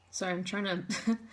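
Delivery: tearful, gathering herself; steady the voice through tears; choked up. Real, not acted. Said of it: "trying to recompose myself".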